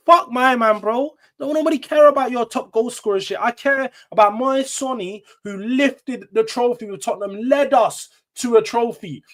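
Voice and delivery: heated rant. Real, not acted. Heated defence of Sonny.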